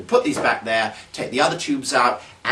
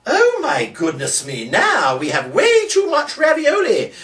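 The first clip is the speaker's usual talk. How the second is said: slow, much higher, hammed-up wail; mock dismay; 14 semitones higher, 6 dB louder, slower than usual. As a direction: pretend the trick went horribly wrong. Trick goes wrong, mock dismay.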